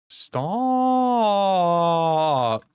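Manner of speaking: high drawn-out cutesy stop. Acted. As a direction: whine it like a pouting cutie. Protesting being called cute.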